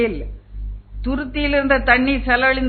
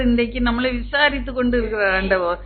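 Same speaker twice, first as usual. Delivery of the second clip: normal talking voice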